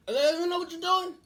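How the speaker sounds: high, loud protest; hammed-up angry holler; retold impatient outburst. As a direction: yell at an incompetent doctor. Impatient father yelling at doctor.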